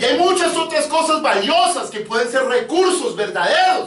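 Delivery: high, slowed, ringing pulpit delivery; emphatic preaching. Real, not acted. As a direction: drive each point home to the congregation.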